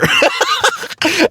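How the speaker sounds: a real burst of high laughter. Real, not acted. Laughing at the husband scolding firefighters, high. Comedian laughing after bit.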